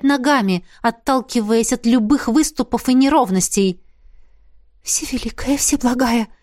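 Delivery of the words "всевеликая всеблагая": imploring murmur to a goddess; understated plea; praying. A narrator's reading. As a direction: pray anxiously. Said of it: "продолжила молиться королева".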